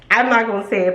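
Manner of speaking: ordinary talk, nothing marked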